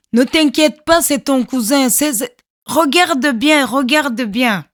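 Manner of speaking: urgent reassurance. Retold or imitated. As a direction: insist she look closely.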